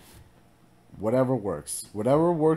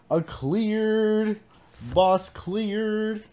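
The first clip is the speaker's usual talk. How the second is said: higher and louder, cheering the win; excited announcement; 9 semitones higher, 4 dB louder, much slower than usual. Real, not acted. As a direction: call out the victory. Boss fight just won.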